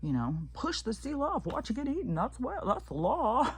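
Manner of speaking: high, mocking voice, laughing through it; mocking zealots. Vlogger mocking people online, in a high sneering voice. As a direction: parody the smug people who say it's the law. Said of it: "these people".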